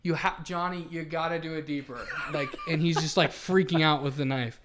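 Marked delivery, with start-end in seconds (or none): 0.0-2.0 urging scoutmaster. Retold or imitated. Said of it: "he was like".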